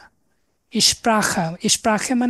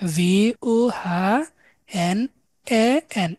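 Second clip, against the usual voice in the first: slow spelling; much slower than usual. Shown as a demonstration. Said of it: "এটা ডয়েসটা হচ্ছে wohnen".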